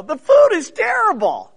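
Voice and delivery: high, loud, whining plea from a jail cell; whiny prisoner complaint. Retold or imitated. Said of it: "if I'm in prison, expect to get a letter".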